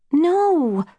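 high, drawn-out, voiced refusal; impatient whisper. A narrator's reading. Snow refusing her sister's story, mildly impatient. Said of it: "Snow whispered impatiently".

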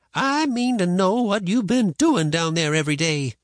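firm, high-pitched grandma. A narrator's reading. Grandma questioning boy about the river, firm. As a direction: demand an answer firmly. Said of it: "she said firmly".

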